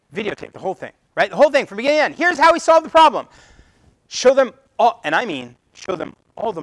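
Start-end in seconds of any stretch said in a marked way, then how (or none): 1.3-5.0 much louder and higher, pressing hard; urgent emphasis. Real, not acted. Speaker urging audience to show the whole process, loudly. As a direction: sell it with full force.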